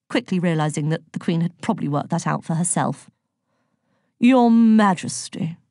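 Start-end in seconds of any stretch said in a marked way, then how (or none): 4.2-5.6 slow, grand, louder bow of a voice; grand horse. A narrator's reading. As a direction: stretch it out with great pomp. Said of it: "said Pegasus grandly".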